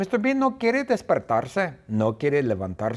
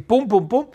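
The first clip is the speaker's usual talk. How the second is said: high loud pum pum; running thumps; 10 semitones higher, 6 dB louder than usual. Acted. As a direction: mimic hurried footsteps. Running out of house. (golpes rápidos de pasos)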